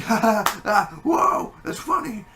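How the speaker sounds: high, loud, exaggerated guffawing; mock loud laughing. Moviegoer overreacting to a joke, hammed-up laughter. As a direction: imitate someone cracking up loudly. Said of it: "I'm laughing too, but I'm not like".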